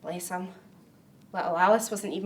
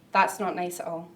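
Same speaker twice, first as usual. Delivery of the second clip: ordinary talk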